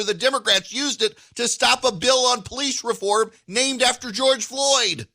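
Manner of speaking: indignant rant swelling into a shout; rising outrage. Real, not acted. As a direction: climb into a furious shout by the end. Indignant rant about the filibuster.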